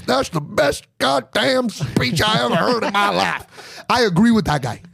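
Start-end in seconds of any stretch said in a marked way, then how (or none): 0.0-3.8 slow, awed fan voice; impressed fan. Retold or imitated. Comic voicing a Trump fan praising the speech, slow and awed. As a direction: gush like a rally-goer, slow and drawn out. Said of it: "the guy that goes".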